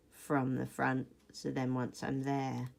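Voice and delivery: ordinary talk, nothing marked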